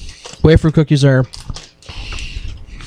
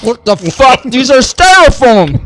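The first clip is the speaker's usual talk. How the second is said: shocked outburst; 7 semitones higher, 5 dB louder than usual. Real, not acted. Bites disgusting styrofoam-like chips.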